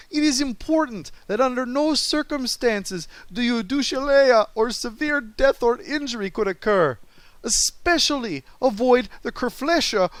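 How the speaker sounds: put-on high, loud hammy Russian-accent gibberish; mock Russian accent. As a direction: overdo a thick Russian accent. Parody of Chekhov gibberish briefing.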